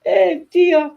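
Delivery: usual speaking voice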